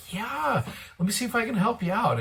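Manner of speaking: high, bright-eyed eager agreement; eager yes. Retold or imitated. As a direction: jump in willingly, a touch excited. Agreeing to give the blessing.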